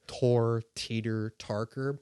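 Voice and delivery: slow "Tor Teeter Tarker" with T for P; ventriloquist T for P. Shown as a demonstration. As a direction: say "Tor Teeter Tarker" slowly, lips still. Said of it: "you replace it and you do".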